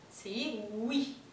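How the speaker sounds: ordinary talk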